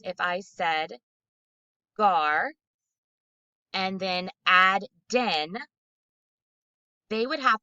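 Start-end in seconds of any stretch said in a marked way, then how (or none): 2.0-5.5 "gar" and "den" each said alone, slow and distinct; syllables given separately. Shown as a demonstration. Teacher giving students parts to add, syllables given separately. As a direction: set "gar" and "den" apart as isolated syllables. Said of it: "the syllables separately".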